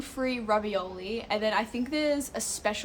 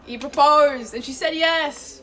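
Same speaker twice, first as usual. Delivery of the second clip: high, loud, slow gushing news; excited announcement; 5 semitones higher, 9 dB louder, slower than usual. Real, not acted. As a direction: announce the engagement in delight. Announces a friend's engagement.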